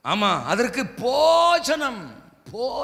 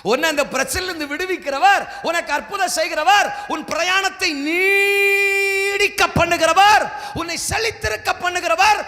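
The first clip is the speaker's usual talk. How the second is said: booming divine voice in a sermon; preaching as God; 5 semitones higher, 3 dB louder than usual. Acted. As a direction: proclaim God's promises over the crowd.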